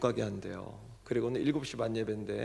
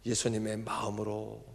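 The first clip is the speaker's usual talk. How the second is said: quieter, lower opening of the phrase; soft setup; 4 semitones lower, 5 dB quieter than usual. Shown as a demonstration. Pastor to congregation, a hushed statement first. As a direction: let 예수님의 마음으로 drop low and soft before the build. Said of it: "도무지".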